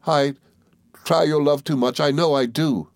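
high, plaintive confession; understated tearful pleading; sobbing. A narrator's reading. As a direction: lightly sob out the self-blame. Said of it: "she sobbed".

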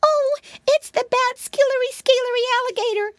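very high squeaky alarm; frightened cry. A narrator's reading. Piglet sure an alligator is at the door, frightened cry. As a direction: squeal it in a tiny panicked piglet voice. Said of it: "cried Flop Ear".

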